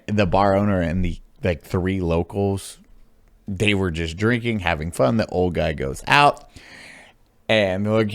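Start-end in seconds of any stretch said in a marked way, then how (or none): none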